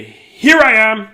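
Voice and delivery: slow, high, loud surprise cry; loud pop-out shout. Acted. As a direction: jump out and shout it. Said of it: "Then pop out and say".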